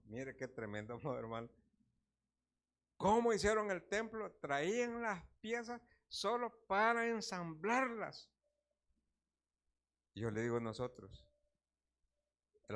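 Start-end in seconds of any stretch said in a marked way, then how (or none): none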